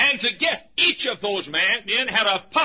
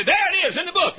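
eager interjection; 9 semitones higher, faster than usual. Retold or imitated. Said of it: "a few times he'd say".

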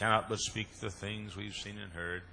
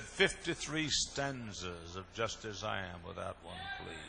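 slow drawn-out complaint; slower than usual. Retold or imitated. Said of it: "He said".

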